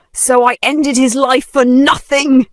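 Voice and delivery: high, loud anguished shout. Acted. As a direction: scream it out in despair. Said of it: "she shouted".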